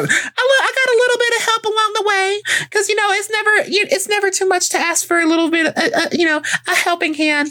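high, sheepish falsetto aside; coy. Acted. Admitting a friend helped pay, in a coy high voice. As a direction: play it coy and innocent.

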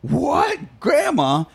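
high mock disbelief; shocked grandson. Grandson learning of grandma's tax debt, shocked.

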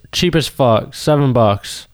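usual speaking voice

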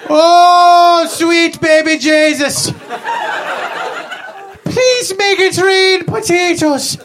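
starving Irish peasant. Acted. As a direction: ham up the dying famine victim begging for potatoes. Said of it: "it was like a little play".

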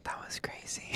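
hushed ASMR whisper. Acted. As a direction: keep it a soft whisper.

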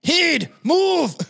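high, hammed-up Scottish bark; swooping yell in a thick Scottish accent; Mike Myers. Movie father orders his son aside, Scottish accent. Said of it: "Mike Myers Scottish voice".

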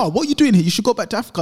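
mock hostile outburst, high and loud; raw mimicked hostility. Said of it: "just raw".

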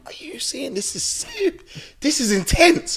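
acted astonished aside to mum; stunned aside. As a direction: squeak it out, incredulous. His stunned aside to mum, retold.

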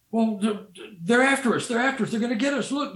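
high, rushed frightened babble; panicked. Retold or imitated. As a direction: gasp it out as if still fleeing. Terrified men retelling the chase.

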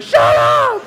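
high, loud outburst; mock furious scream. Trapped patient yelling at [name], furious scream. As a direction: scream it at the soothing music.

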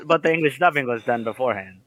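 normal talking voice

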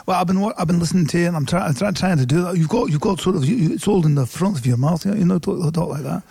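quick, rough stab at a Mackem accent; attempted Sunderland accent. Shown as a demonstration. American guest imitating his host on request, attempted Sunderland accent. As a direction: try out Sunderland speech from the front of the mouth. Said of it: "can you have him do a Mackem accent, a Sunderland accent like me?".

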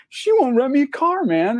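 high, exasperated rock star whine. Retold or imitated. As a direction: protest in a high, put-upon voice. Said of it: "he's doing the [name] thing".

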